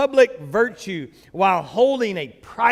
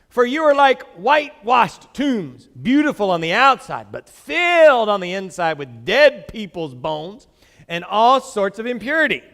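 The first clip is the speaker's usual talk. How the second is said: louder forceful scripture reading; preaching scripture; 7 dB louder than usual. Acted. Pastor reading Jesus' rebuke to the congregation, forceful. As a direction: proclaim the woes with weight.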